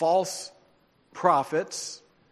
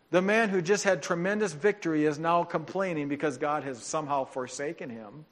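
ordinary talk, nothing marked

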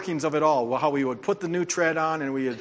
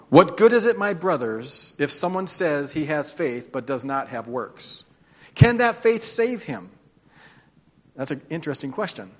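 scripture reading; 4 dB louder than usual. Acted. Reads scripture aloud.

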